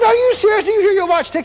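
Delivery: stunned, loud, high-pitched question; amazed. Retold or imitated. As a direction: ask it as if you can't believe it. Astonished at the healed man.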